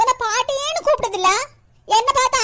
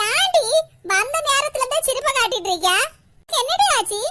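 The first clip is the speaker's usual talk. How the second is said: exasperated scolding; 4 semitones higher than usual. Acted. Mother scolds daughter for acting oddly.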